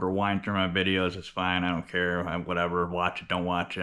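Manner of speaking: ordinary delivery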